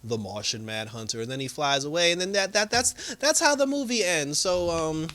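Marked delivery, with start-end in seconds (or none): 0.0-1.2 low, soft, solemn introduction; lightly imitated grave hero; Martian Manhunter. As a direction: announce the name quietly and low. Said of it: "he's just like".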